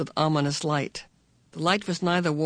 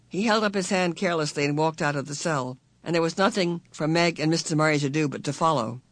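plain reading voice

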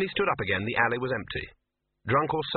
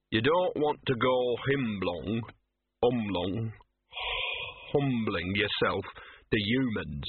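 slow slurred lecture, tripping over a word; Gaspode, drunken lecturing dog; slower than usual. A narrator's reading. Gaspode lecturing Laddie on true dogness, drunken lecturing. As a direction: labour the words like a drunk.

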